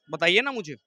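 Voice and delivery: ordinary talk, nothing marked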